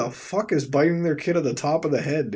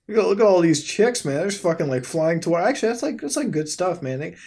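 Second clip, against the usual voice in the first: ordinary talk, nothing marked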